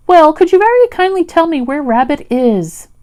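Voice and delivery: the bear calling politely down a rabbit hole; polite Pooh. A narrator's reading. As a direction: ask ever so kindly into the hole.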